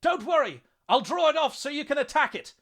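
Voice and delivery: very high, loud fervent yell; urgent shouted reassurance at fever pitch; fervent, overconfident shout. A narrator's reading. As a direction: holler with misplaced confidence. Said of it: "his voice rising to a fervent pitch".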